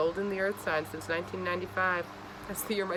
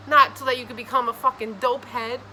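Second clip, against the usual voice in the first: God scolding; 5 semitones higher, 6 dB louder than usual. Acted. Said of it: "this is the God telling you".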